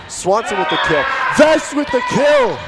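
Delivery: high, loud excited call; excited shouting. Real, not acted. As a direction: yell out the kills. Rapid kills called.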